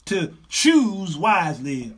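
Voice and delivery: loud, high preacher's exhortation; rally the women from the pulpit; preaching at full force. Acted. Preacher urging women in the audience, full-force preaching.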